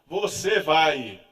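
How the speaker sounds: firm insistence. Retold or imitated. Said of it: "falava com ela".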